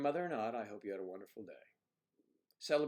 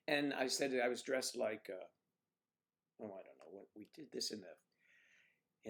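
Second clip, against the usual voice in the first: usual speaking voice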